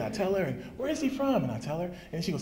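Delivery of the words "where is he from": lightly voiced child, much higher; a hint of a curious little girl; curious daughter. Father voices daughter's questions.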